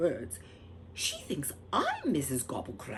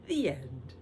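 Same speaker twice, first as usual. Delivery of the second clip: ordinary delivery, nothing marked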